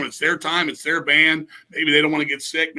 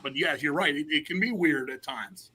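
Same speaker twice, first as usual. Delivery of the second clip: normal talking voice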